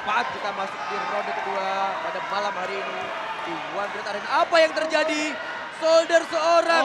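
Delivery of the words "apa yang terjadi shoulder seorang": sudden loud alarmed call; surprised shout. Real, not acted. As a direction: blurt it out in alarm. Commentator reacts to injury.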